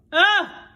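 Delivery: short high exasperated ah; frustrated cry. A narrator's reading. Girl held down, venting frustration. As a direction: let out a stifled cry.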